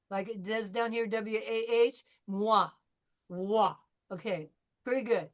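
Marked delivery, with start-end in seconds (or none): 1.1-3.7 moi with wah sound; spelled-out moi. Shown as a demonstration. Teacher models moi for Ritu. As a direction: say moi as mwah. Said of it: "I'd be happier if you went moi".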